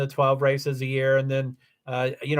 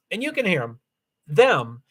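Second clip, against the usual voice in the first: ordinary delivery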